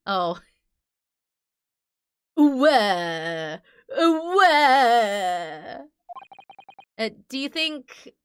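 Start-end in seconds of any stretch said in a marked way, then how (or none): 2.4-5.8 mock crying. Mock crying again as character.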